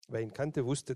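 ordinary delivery, nothing marked

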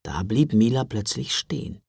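ordinary delivery

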